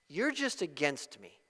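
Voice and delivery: ordinary talk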